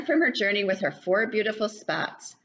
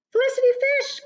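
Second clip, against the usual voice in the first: high, louder call of the name; 5 dB louder, much faster than usual. Acted. Lucy calling to the fish, high and loud. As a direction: call across the pond, high. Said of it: "called Lucy".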